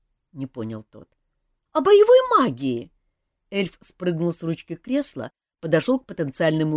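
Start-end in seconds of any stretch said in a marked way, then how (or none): 1.7-2.9 eager exclamation. A narrator's reading. Принц восклицает.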